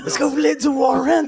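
high shouted DJ call to the crowd; DJ hype shout. Pretend. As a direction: pump up the crowd like a DJ. Mock DJ hyping crowd.

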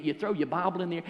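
ordinary talk

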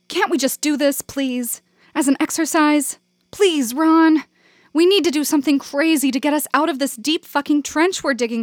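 high, urgent begging; pleading. A narrator's reading. Janet begging Ron to do the exercise, high and urgent.

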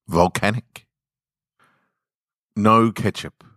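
ordinary delivery, nothing marked

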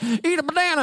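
taunting pals pushing a banana in his face; teasing taunt. Retold or imitated. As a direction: needle him like a tease shoving fruit at him. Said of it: "come up and be like".

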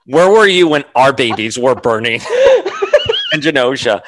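mock-outraged question broken up by a laugh; laughing outrage. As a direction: play the fury but crack up laughing. Mock outraged Emma quote, laughing.

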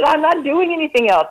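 high, firm refusal; retold refusal. Patient refusing treatment to her oncologist, high and firm. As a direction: dig in, pitched well up. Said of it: "I said".

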